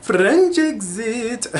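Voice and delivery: put-on high, slow French-accented title; imitating a French accent. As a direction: copy the singer's French accent, drawn out. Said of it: "she put on, like, a French accent".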